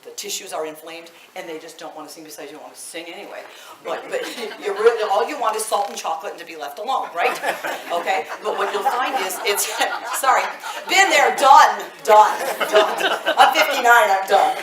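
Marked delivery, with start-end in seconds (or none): 3.8-14.6 laughing while talking. Real, not acted. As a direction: speak through real laughter. Laughing about menopause.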